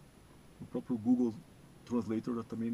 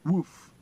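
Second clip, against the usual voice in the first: a loud, high woof like a barking dog; imitated dog bark; 6 semitones higher, 13 dB louder than usual. Shown as a demonstration. Teacher showing the English dog sound, loud bark. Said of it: "Em inglês".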